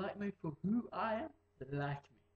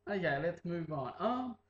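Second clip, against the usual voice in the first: usual speaking voice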